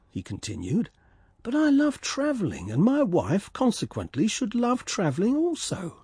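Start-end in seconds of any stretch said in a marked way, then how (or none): 1.4-6.0 high, self-satisfied little swallow. Acted. Swallow explaining his love of travel, high. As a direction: lift it high and pleased with himself.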